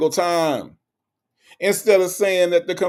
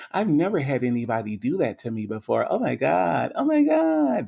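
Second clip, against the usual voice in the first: mock swooning Sonya. Reading a comment, imitating Sonya's swoon: mock swooning. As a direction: ham up a woman overcome with pleasure. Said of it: "That was her. That's how she sound".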